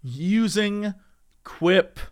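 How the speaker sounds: low, slow spooky storyteller; spooky announcer. Acted. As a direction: creep it up like a campfire tale. Spooky ad read.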